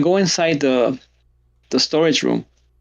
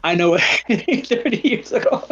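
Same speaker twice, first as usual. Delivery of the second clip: laughing; 6 semitones higher than usual. Real, not acted. Laughing at friend's joke.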